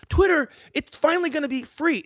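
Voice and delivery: high, airy mocking quote; mock naive voice. Mocking Twitter fans with a high, airy quote. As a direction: sneer at the gullible optimists.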